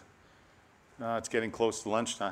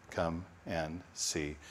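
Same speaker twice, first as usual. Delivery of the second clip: slow, low and soft; tender invitation; 5 semitones lower, 7 dB quieter, much slower than usual. Acted. Preacher repeating Jesus's invitation, slow and tender. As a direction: draw each word out gently.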